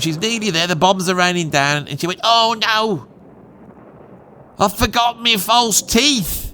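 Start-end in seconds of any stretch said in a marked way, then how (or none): 2.2-6.4 put-on high-pitched lament in his own accent; a hint of a dismayed old nan, drawn out; lightly acted nan. Nan remembering her false teeth in an air raid, lightly acted dismay.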